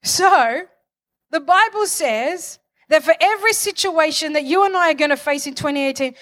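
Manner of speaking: ordinary delivery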